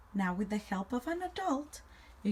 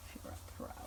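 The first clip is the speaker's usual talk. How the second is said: breathy whispered echo; whispering; 14 dB quieter, much faster than usual. Acted. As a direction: whisper it back. Whispered repeat.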